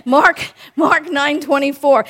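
ordinary talk, nothing marked

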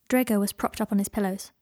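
ordinary delivery, nothing marked

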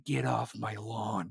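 low, slow, quiet Clint Eastwood growl; mock Gran Torino warning from the porch. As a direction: grumble it like an old man on his porch. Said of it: "like fucking Clint Eastwood on his fucking porch saying".

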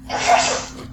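breathy secret whisper of a name. Acted. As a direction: lean in and whisper it unvoiced. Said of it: "I'll do the same thing".

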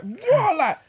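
high, laughing shout of disbelief. Retold or imitated. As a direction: cry out in amused shock. Teammates baffled by scraping player.

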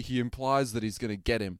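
normal talking voice

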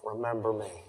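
very soft, low, humble voice; humble plea. Retold or imitated. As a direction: say it quietly, as a humble prayer. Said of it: "humility to it".

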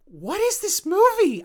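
high, loud, drawn-out disbelief; bewildered outburst. Retold or imitated. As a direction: cry out in total bafflement. Retelling baffled reaction to film.

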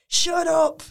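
high, loud playful "shut up"; mock retort. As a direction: snap back at the crowd in fun. Mel B retorts to crowd.

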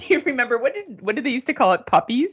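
high, giggling mock nostalgia; mocking, laughing. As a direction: laugh through a silly fan voice. Mocking old-timer fans.

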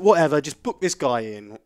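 loud, exasperated brush-off; fuming. Retold or imitated. Fed-up customer telling the agent to book the engineer, fuming. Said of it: "Fuming at this point".

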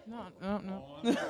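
ordinary delivery

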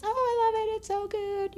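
high, bubbly imitation of the happy bride; gushing bride. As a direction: gush like a thrilled newlywed. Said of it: "And she goes".

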